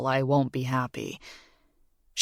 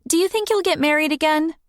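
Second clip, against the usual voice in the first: put-on much higher, quick girl's question; curious young girl; 13 semitones higher, faster than usual. A narrator's reading. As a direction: blurt it out like a curious kid. Morgan voiced high.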